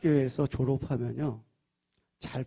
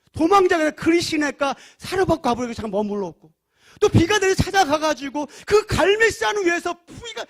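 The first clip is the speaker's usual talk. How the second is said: rising, louder sermon build; fiery preaching; 10 semitones higher, 10 dB louder than usual. Acted. Preacher to congregation, loud and fervent. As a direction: preach it with climbing fervor. Preacher recounts Elijah's deeds.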